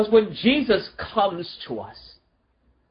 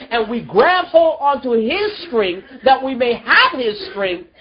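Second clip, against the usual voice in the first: higher and louder, driving each phrase; full-force preaching; 5 semitones higher, 3 dB louder than usual. Acted. Preacher rousing the congregation about repentance, full force. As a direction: build the congregation up to rejoicing.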